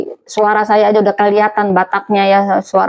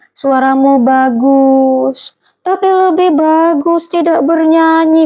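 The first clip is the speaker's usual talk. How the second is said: mock-gentle jab; 6 semitones higher, slower than usual. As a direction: draw out the insult with fake gentleness. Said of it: "cuman bicaranya lembut, tapi kata-katanya menusuk".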